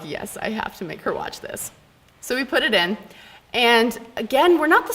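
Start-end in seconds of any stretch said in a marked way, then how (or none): 0.0-1.7 hushed plotting; scheming whisper. Retold or imitated. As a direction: whisper it like a sneaky plan. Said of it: "so I'm like".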